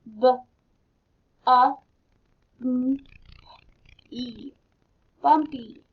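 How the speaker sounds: letter by letter, then belly blended; teacher sounding out letters. Shown as a demonstration. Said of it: "let's sound these words".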